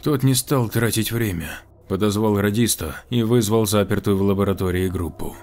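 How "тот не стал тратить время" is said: plain reading voice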